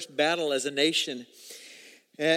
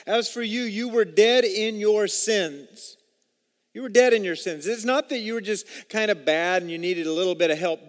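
fervent preaching; 4 semitones higher, 5 dB louder than usual. Acted. Pastor pressing the congregation, preaching with rising force.

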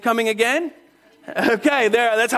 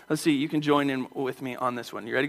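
normal talking voice

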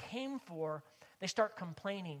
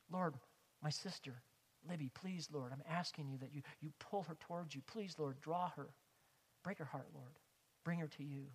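soft, low, intimate petition; hushed example of heartfelt prayer; pleading prayer; 3 semitones lower, 7 dB quieter than usual. Shown as a demonstration. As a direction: quietly beg God for a loved one. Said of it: "Isn't that the way we often pray".

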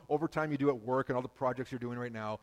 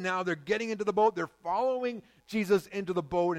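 louder, driving sermon voice; preaching; 7 semitones higher, 9 dB louder than usual. Acted. Pastor stirring up his church, raised preaching voice. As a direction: press the story on the congregation.

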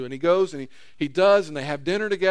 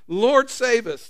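panicked plea; 6 semitones higher, 6 dB louder, slower than usual. Retold or imitated. Disciples crying out in storm.

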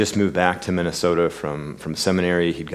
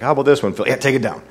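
put-on Brooklyn accent; 6 semitones higher, 5 dB louder, faster than usual. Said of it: "come out with this great Brooklyn accent".

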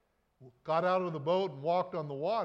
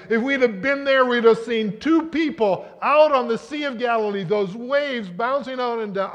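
loud, high, driving sermon voice; full-force preaching; 5 semitones higher, 12 dB louder than usual. Acted. Preacher to congregation, loud and soaring. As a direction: preach it out big to the whole room.

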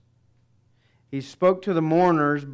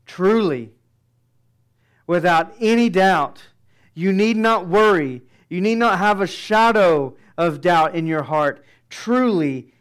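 loud, high, insistent preaching; declaring with full force; emphatic preaching; 4 semitones higher, 8 dB louder than usual. Acted. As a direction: hammer home the certainty. Preaching the certainty of the promise.

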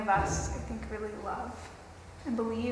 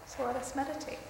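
guided meditation; 3 dB quieter than usual. Acted.

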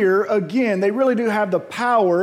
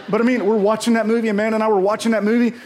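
normal talking voice

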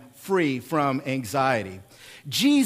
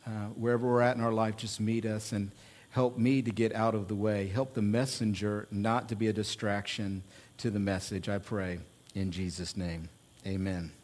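soft, low opening prayer; 8 semitones lower, 8 dB quieter than usual. Real, not acted. Pastor praying over the congregation, softly reverent. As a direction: pray it quietly and humbly.

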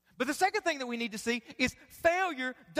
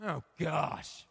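low, soft mock-weary wife; imitating wife; 11 semitones lower, 5 dB quieter than usual. Pastor imitating his wife's weary reply, low mock sigh. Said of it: "My wife said".

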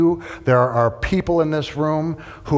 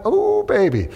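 high excited exclamation; 11 semitones higher than usual. Acted. Preacher warning crowd.

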